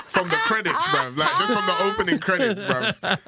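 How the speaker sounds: high-pitched, laughing protest. Real, not acted. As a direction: get the words out through real laughter. Joking about being scared stuffed.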